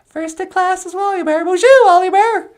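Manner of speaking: very high, bright greeting; a cheerful high puppet hello; Natasha's puppet voice. Acted. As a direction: pitch way up for the puppet. Puppet Natasha praises Wally Bear.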